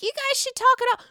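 high-pitched mocking imitation; Teresa. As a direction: squeak it out as a mock peacemaker. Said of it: "Teresa's like".

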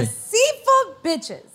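slow, high, drawn-out scorn; mock outrage. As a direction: drag it out with sass. Mock outrage at cheating girl.

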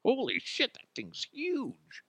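high, slow awed gasp; mock amazement. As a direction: gawk at it in fake wonder. Joking gasp at imagined size.

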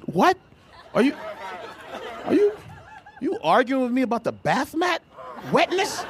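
stammering mock disbelief, high and punchy; halting, baffled comeback to girlfriend; incredulous outburst. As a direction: stop and restart in comic outrage. Incredulous reply in retold quarrel.

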